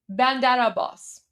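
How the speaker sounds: "Bandar Abbas" with the R rolling straight into the A, "Bandara-bbas"; run together as one word. Shown as a demonstration. Showing viewers to blend the two words, smooth and linked. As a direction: let "Bandar" flow into "Abbas" with no break. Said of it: "There you go".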